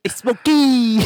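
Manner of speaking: very high, loud movie-character cry; hammed-up shriek of a name; movie impression. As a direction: holler it in a high put-on voice.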